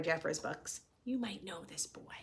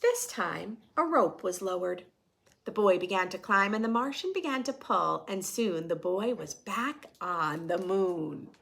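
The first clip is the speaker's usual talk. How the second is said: storytelling voice; 5 dB louder than usual. A narrator's reading. Reading picture book to class.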